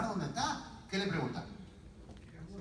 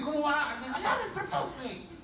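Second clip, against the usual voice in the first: high, incredulous protest; mock disbelief; 9 semitones higher than usual. Sibling following woman giving perfume away, mock disbelief. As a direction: protest the waste comically.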